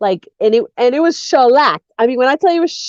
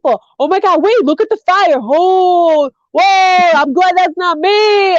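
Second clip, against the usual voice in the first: nosy gawking that builds to higher exclamations; acted rubbernecking; 4 semitones higher, faster than usual. Playing a driver slowing to stare at a car crash, mounting excitement. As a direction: start curious, climb into mock alarm.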